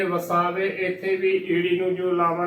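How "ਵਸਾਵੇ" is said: ਵਸਾਵੇ as "va-saa-ve"; wrong three-syllable reading. Shown as a demonstration. Teacher showing the congregation's mistake, ਵਸਾਵੇ as "va-saa-ve". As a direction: join e directly to the last v of ਵਸਾਵੇ. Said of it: "ਉਹ ਨਹੀਂ ਉਚਾਰਨ ਕਰਦੀਆਂ".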